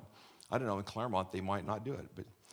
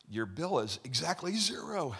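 acted announcement; 7 semitones higher, 3 dB louder than usual. Telling next car, acted.

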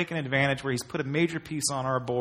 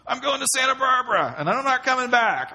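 mock dramatic escape; 10 semitones higher, 3 dB louder than usual. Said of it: "I'm like".

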